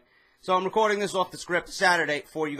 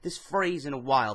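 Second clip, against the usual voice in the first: ordinary talk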